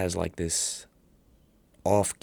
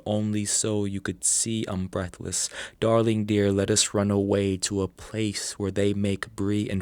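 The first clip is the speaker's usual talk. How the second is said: measured, projected reading on a level, raised tone; poetry recitation; 4 semitones higher, 3 dB louder than usual. Acted. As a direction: recite it like spoken-word verse. Reads own poem aloud.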